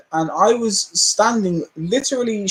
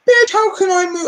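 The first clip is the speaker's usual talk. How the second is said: much higher, louder indignant snap; retold angry retort; 14 semitones higher, 4 dB louder than usual. To the old lady with the trolley, an indignant retort. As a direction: fire it back at her in outrage.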